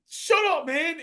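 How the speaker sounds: high-pitched mock tearful protest; defensive retort. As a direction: re-enact an embarrassed, weepy comeback. Retold defensive reply while crying.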